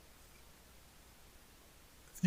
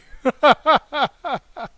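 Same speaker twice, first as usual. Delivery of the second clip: loud laugh; 9 semitones higher, 12 dB louder than usual. Real, not acted. Laughs at five G joke.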